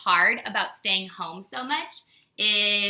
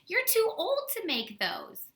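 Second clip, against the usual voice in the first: higher, slow mocking singsong; imagined kids mocking her, higher and slow; teasing; 11 semitones higher, slower than usual. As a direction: tease the teacher like a snickering kid. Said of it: "laugh at me and say".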